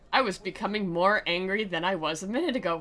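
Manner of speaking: ordinary delivery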